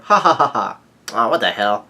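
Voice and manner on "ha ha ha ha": loud, high villain cackle; mock evil laugh. Pudding gloating over their doom, mock evil laugh. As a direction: spell out a hammed-up gloating laugh. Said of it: "Pudding has become evil again".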